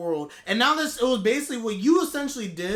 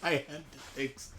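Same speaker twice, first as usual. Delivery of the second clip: mocking Keisha; 7 semitones lower, 4 dB quieter than usual. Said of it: "Keisha's like".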